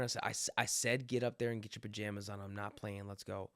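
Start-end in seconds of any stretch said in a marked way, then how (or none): 1.1-3.5 stern. Retold or imitated. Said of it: "if I gotta get like a little bit stern".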